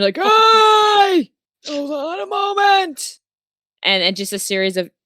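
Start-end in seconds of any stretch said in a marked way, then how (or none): 0.2-3.2 put-on high old man shouting through the door; old wizard calling out. Wizard shouting to visitors at his door, high old voice. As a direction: call out from inside, startled. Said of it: "you hear from him".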